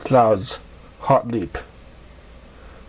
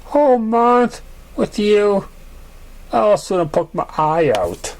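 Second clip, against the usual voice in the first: teasing pony; 9 semitones higher, slower than usual. Acted. Cloud teasing, character voice.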